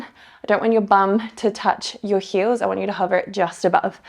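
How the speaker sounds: usual speaking voice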